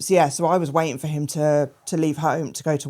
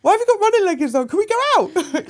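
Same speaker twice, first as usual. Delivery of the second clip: put-on much higher, loud, fast, eager child-like voice; puzzled little Blade; 5 dB louder, faster than usual. Blade finds mum on the floor, high excited pestering. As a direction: pester her like an excited kid. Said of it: "He was like".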